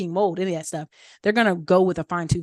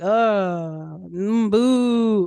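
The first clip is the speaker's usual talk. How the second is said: mock booing; 3 dB louder, much slower than usual. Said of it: "they're like".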